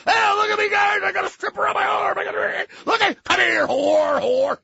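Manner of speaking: high loud boasting; rowdy yell. Retold or imitated. Farley boasting about strippers, yelling high. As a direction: show off at the top of your lungs.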